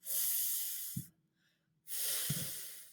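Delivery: breathy unvoiced f-, held briefly; isolated F sound. Shown as a demonstration. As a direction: blow out a soft f-.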